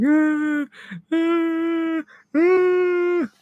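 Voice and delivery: high, wailing sobs; mock sobbing wife. Wife crying over her passed-out husband, high mock wails. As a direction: imitate the wife bawling. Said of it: "she's just crying her eyes out".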